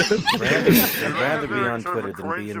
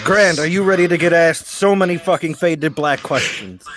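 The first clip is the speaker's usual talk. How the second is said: ordinary delivery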